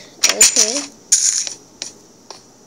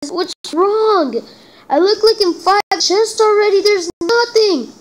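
frustrated outburst; 8 semitones higher than usual. Real, not acted. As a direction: rant at the broken game in disbelief. Frustrated gamer finds no swords.

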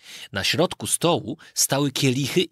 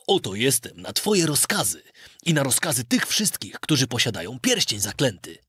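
ordinary delivery

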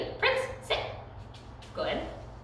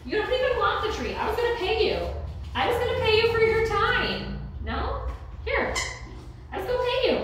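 high, playful scolding of a dog; playful teasing; 13 semitones higher than usual. Real, not acted. Trainer teasing her dog about the tree, high and playful. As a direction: tease him like a pet for ignoring the treat.

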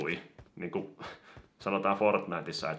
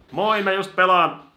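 mock phone answer; 10 semitones higher, 12 dB louder than usual.

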